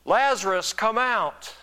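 loud, high command, calling out. Acted. Jesus summoning Lazarus from the tomb, loud calling voice. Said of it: "Jesus called in a loud voice".

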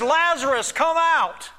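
high, loud commanding call. Acted. Jesus calling Lazarus out of the tomb, loud voice. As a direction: call it out as if summoning the dead. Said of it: "In a loud voice, he says".

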